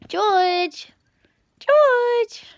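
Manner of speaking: high loud call to a cat. Real, not acted. Owner calling her cat across the room, loud high call. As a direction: sing out the cat's name, high and loud.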